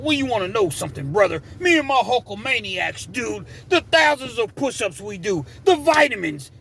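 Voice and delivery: high-pitched wrestler impression; the Hulkster. Storyteller mimicking how Big Will talked, in a high wrestler voice. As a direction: imitate a wrestler's promo, pitched well up. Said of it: "he sounded like the Hulkster".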